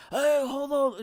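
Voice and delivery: high, loud mock yell; imitated shout from someone running up; yelling to stop. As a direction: call out to halt a departing bus. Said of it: "It's just like somebody yelling".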